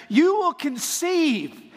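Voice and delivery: hammed-up angel proclamation; booming angel. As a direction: proclaim it like a herald. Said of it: "he says".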